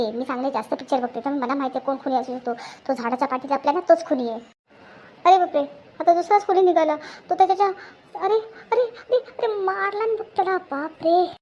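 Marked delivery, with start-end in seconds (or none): 5.2-11.3 high gasping exclamations; shocked excitement. Acted. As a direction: react to a shocking film twist, pitch up. Shocked by killer twist in film.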